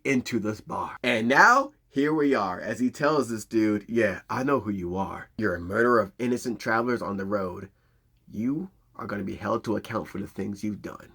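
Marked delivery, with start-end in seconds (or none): 3.9-11.1 soft, low, cold threat; quiet menace. Retold or imitated. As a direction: lean in and threaten him calmly. Eli confronting the murderer.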